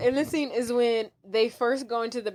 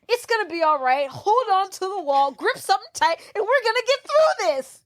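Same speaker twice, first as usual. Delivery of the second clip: high, rising Texan pep talk; Texan man; 8 semitones higher than usual. Acted. Texan encouraging the man in the next stall, high mock pep talk. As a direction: coach him through it, climbing higher. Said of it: "very Texas man come into the stall".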